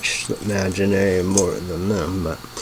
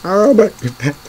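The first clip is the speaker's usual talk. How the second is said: surprised exclamation; 10 semitones higher, faster than usual. Real, not acted. Reacting to the list.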